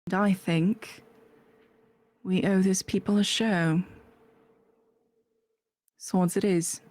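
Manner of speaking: put-on accented character voice, measured; put-on accent with long pauses; Gemma. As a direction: weigh each choice in the character's accent. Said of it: "I didn't do the accent".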